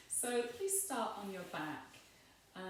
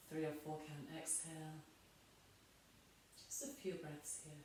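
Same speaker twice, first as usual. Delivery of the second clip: guided meditation; 9 dB quieter than usual. Real, not acted. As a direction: guide them gently down into body and breath. Guides body awareness and breathing.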